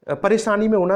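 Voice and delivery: ordinary talk, nothing marked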